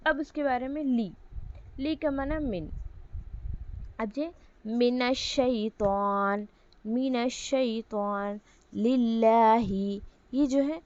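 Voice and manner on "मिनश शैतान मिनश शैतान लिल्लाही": each Arabic word drawn out slowly for students; slow model reading. Shown as a demonstration. Teacher slowly models Arabic words for the class to repeat. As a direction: spell out मिनश Shaitaan and लिल्लाही very slowly. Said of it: "teacher models Arabic words slowly".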